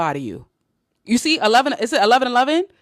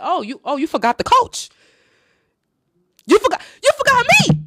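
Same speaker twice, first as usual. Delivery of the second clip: mock offended coach; 12 semitones higher, 6 dB louder than usual. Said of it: "I'm like".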